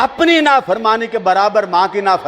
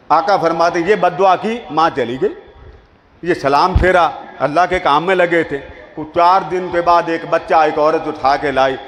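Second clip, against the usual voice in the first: normal talking voice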